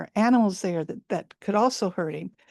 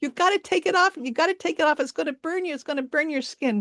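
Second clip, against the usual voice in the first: much higher, urgent monkey chatter; high, worried monkeys running after the hunter; alarmed monkeys; 7 semitones higher, faster than usual. Acted. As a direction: call after him in a panic like fretting monkeys.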